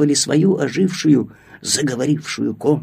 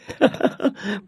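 light sugary chuckle; sweet little laugh; 6 semitones higher, 5 dB quieter than usual. Acted. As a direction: give a soft sweet laugh. Сахар смеётся. (сладкий смешок)

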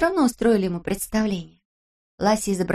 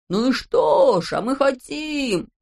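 slow, louder rough voice; gruff shout; 5 semitones higher, 3 dB louder, slower than usual. Acted. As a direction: bellow like rowdy tramps. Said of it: "закричали мы грубыми голосами". (грубый крик)

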